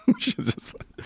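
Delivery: breathless laugh through the line. Real, not acted. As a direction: laugh at your own bad choice. Repeats it while laughing.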